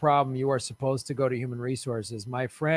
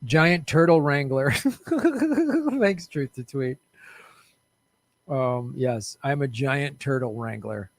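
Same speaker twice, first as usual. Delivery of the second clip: usual speaking voice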